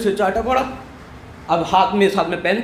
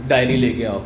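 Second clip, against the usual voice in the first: stern father; 10 semitones lower than usual. Retold or imitated. Father at home demanding the diary, low stern voice. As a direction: order it like a strict dad.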